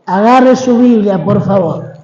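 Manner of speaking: normal talking voice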